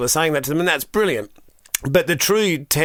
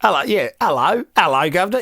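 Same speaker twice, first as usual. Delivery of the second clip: a light Cockney "hello, governor"; chirpy London street hello; Cockney greeting; 2 semitones higher than usual. Retold or imitated. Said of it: "he's just like".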